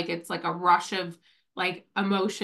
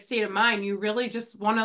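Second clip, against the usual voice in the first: ordinary talk, nothing marked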